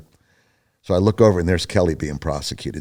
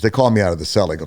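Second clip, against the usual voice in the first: ordinary talk, nothing marked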